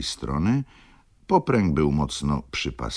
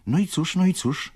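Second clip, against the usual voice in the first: high-pitched, impatient prodding; impatient questioning. A narrator's reading. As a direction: press him for an answer, voice pitched high. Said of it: "pytała niecierpliwie". (niecierpliwe, wysokie dopytywanie)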